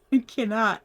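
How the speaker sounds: usual speaking voice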